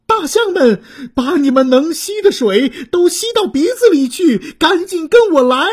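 rally the elephants, fast; urgent command. Acted. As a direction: giraffe urging elephants to the fire. Urgent orders at fire.

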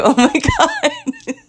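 very high, drawn-out squeal through laughter; laughing disbelief. Retold or imitated. Woman retelling nearly dropping her dog, laughing disbelief.

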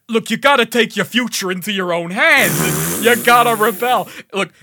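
mock rousing speech to start a revolution; dumbass hype speech. Game character urging people to rebel, hammed-up hype. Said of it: "just hyping up the revolution, but in this dumbass way".

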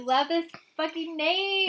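high, loud gushing; really excited. Real, not acted. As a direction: belt out your enthusiasm. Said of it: "we're both really excited about this".

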